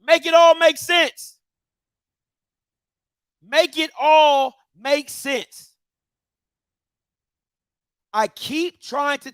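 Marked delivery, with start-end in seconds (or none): 0.0-1.4 loud, high, fed-up demand; exasperated shout. Real, not acted. Ranting at HBCUs about money, a loud exasperated shout. 3.4-5.7 very slow, every word spaced out; slow emphatic repeat. Real, not acted. Repeating his demand to the schools, slow and deliberate.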